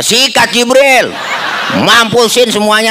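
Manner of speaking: sic him on them with mock glee; gleeful revenge. Preacher's own vengeful answer. (perintah balas dendam dengan girang dan suara tinggi)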